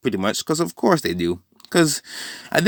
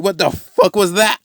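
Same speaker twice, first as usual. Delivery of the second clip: shocked yell; 8 semitones higher than usual. Acted. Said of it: "he's screaming".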